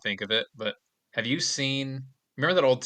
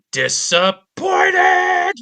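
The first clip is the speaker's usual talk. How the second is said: high, loud, slow shout of disappointed; shouted stage direction; 9 dB louder, much slower than usual. Retold or imitated. Friend mimicking a TV actor, shouting the word. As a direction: belt out Disappointed as a stretched shout. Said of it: "shouts the word disappointed".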